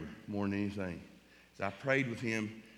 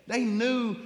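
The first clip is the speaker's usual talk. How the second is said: much higher, near tears, voice cracking; voice breaking high; 12 semitones higher, 5 dB louder than usual. Real, not acted. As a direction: let it break on the words They knew. Emotional testimony about grieving family.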